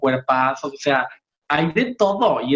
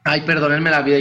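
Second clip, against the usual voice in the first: ordinary talk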